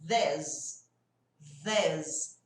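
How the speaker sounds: "this" with a buzzing TH, tongue between the teeth; voiced TH demo. Shown as a demonstration. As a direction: vibrate the TH of "this". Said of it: "Aqui, ó". ("this" com TH vibrando)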